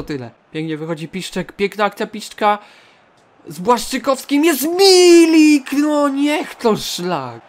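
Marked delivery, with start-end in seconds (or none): 3.5-7.4 high, loud, drawn-out wail; excited cry turning to dismay. Real, not acted. Gamer seeing Milik's chance go wasted, excited then dismayed. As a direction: shout the chance, then groan it away slowly.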